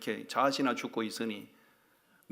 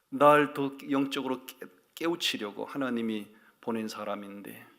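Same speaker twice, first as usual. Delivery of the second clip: ordinary talk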